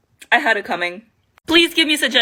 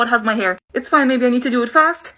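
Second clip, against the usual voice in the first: ordinary talk, nothing marked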